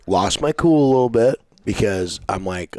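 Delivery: ordinary delivery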